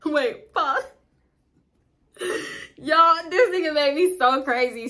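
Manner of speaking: real laughter in the voice; laughing through words. Real, not acted. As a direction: giggle it out, exasperated. Laughing while telling.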